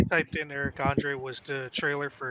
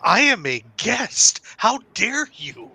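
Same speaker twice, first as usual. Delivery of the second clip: slow, higher, hammed-up outrage; mock affronted; 5 semitones higher, slower than usual. Continuing the joke as the muted guest, mock affronted. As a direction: draw out each word like an offended guest. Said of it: "he's just like".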